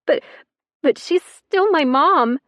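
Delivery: high, slow, stammering sob voice; hiccuping through tears. Acted. Girl defending her mother through tears, high and tearful. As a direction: catch on the first word, crying. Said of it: "voice hiccuping around her tears".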